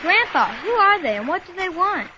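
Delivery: high, loud breathless questions; frightened outburst. Acted. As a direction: blurt it out in fear after surfacing. Boy surfaces after attack, frightened.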